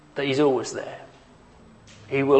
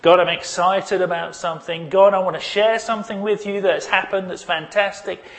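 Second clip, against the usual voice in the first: excited prayer; 6 semitones higher, 4 dB louder than usual. Pretend. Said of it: "Anything you need to say to him".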